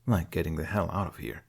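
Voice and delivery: plain reading voice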